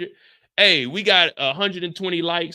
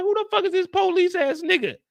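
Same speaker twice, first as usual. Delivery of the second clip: incredulous; 10 semitones higher than usual. Retold or imitated.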